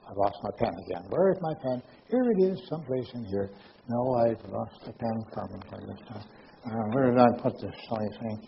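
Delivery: soft, absent-minded searching aside; half to yourself, quietly distracted; talking to himself. Real, not acted. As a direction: drop the volume while hunting for something. Talking to himself, hunting lost pen.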